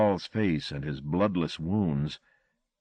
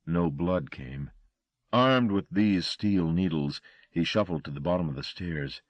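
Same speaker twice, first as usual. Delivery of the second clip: usual speaking voice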